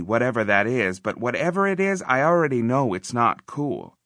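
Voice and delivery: ordinary delivery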